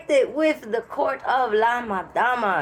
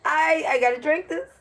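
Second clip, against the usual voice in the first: laughing; 8 semitones higher than usual. Retold or imitated. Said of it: "And I said".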